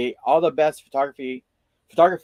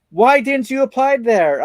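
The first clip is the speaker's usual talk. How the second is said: surprised teacher; 8 semitones higher, 7 dB louder than usual. Retold or imitated. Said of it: "He was like".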